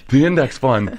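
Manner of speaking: mock amazed echo of "The index fund"; dawning realization. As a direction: echo it as if it finally clicks. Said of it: "And you'd be like".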